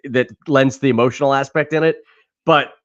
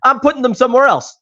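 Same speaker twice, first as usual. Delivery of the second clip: higher, quick huffy retort; mock indignant; 9 semitones higher than usual. Writer answering the bookstore kid, huffy and quick. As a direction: brush the clerk off, huffy. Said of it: "He goes".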